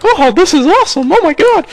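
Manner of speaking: very high, excited squeal of a sucker; gullible noob. Retold or imitated. A gullible player thrilled by the scammer's promise, high and squealing. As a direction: squeal it like a naive kid who is thrilled. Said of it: "the gullible asshole that's like".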